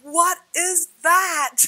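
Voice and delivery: high, loud, slow and emphatic; astonished question. Retold or imitated. Retelling seeing her symbol on the door, loud astonishment. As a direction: stretch each word in amazement. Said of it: "I was like".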